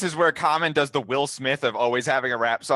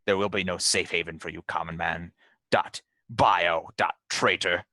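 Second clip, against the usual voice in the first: imperious outrage sinking into a low, cold threat; stern betrayed leader; 3 semitones lower than usual. Acted. Leader banishing a traitor into the space whale, imperious condemnation.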